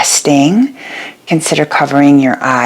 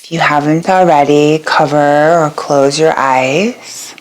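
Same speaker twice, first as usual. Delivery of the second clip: guided meditation. Acted. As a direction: guide them gently into rest, letting each phrase settle. Leads a yoga nidra meditation.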